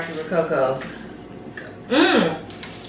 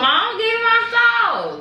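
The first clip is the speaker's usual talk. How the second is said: whiny plea; 14 semitones higher, slower than usual. Real, not acted. Begging partner for the sauce.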